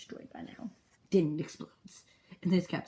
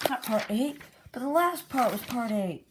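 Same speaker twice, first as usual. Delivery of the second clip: high, raised-voice objection; exasperated disbelief; 7 semitones higher, 5 dB louder than usual. Real, not acted. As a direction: protest the mistake, pitched up. Complains about misnumbered part.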